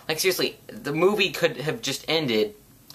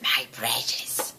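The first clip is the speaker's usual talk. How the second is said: slow, soft, drawn-out Gollum croak; Gollum catchphrase; 2 semitones lower, 5 dB quieter, much slower than usual. Retold or imitated. Gollum lingering over his treasure, slow and soft. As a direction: stretch it out like Gollum hoarding the ring. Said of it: "His stupid little catchphrase".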